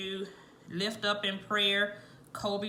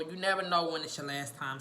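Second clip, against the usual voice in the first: ordinary talk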